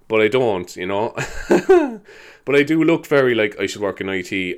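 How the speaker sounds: ordinary talk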